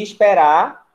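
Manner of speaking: "esperar" slow, with a loud, drawn-out "rar"; stress on RÁ. Shown as a demonstration. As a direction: stretch and punch the last syllable of "esperar". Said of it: "a sílaba tônica continua no Rá". ("esperar" devagar, tônica forte no final)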